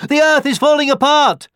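very high, loud alarm; panicked deer. A narrator's reading. Running deer warning the boars, panicked. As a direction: cry the warning in a high fright. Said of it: "panted the deer".

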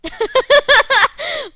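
big burst of high laughter; loud laugh. Real, not acted.

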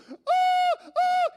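very high, loud "oh" squeals; excited kid squeals. Retold or imitated. Boy as plane readies for take-off, excited squealing. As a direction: whoop like an overwhelmed child.

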